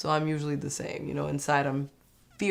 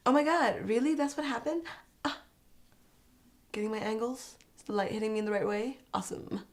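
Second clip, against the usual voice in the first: high, soft mock-innocent act; hammed-up fake surprise, trailing softer; cutest fake facade; 4 semitones higher, 3 dB quieter, faster than usual. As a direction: play cute for the cameras. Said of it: "putting on the cutest facade".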